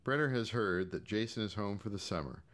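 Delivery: ordinary delivery, nothing marked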